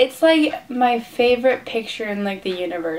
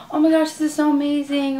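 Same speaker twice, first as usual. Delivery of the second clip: higher, mildly excited imitation of the artist; gushing retold praise; 6 semitones higher, 4 dB louder than usual. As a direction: gush as if quoting an impressed idol. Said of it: "And he's like".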